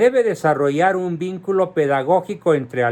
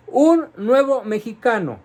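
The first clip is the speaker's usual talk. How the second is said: higher, quote-marked emphasis; pointed air quotes; 6 semitones higher than usual. Real, not acted. Commentator quoting the plan's new citizen, pointed air quotes. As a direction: hold the phrase up at arm's length, higher pitched. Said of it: "entre comillas".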